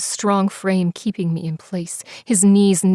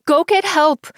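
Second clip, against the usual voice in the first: high loud plea to fetch help; desperate scream; 9 semitones higher, 5 dB louder than usual. Acted. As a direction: scream it after her as she flees. Fallen, screaming at friend.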